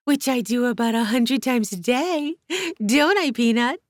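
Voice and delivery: put-on high, loud sing-song baby talk. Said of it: "switched to a baby talk voice".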